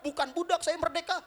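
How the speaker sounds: high-pitched mock yelling; protesting cry. Suwaibit protests being sold at market, high cry. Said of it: "Suwaibit bin Harmalah teriak-teriak".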